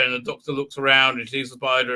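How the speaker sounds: ordinary talk, nothing marked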